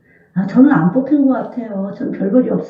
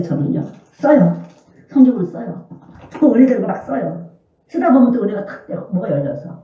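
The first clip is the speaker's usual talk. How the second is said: normal talking voice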